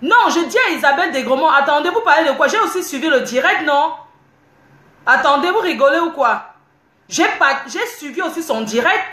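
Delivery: real high-pitched indignant outburst; indignant protest. Real, not acted. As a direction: argue back heatedly at the commenters. Indignant retort to live commenters.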